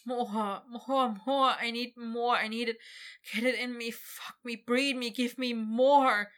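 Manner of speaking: desperate pleading. Acted. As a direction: beg breathlessly, building. Victor pleads to dragon.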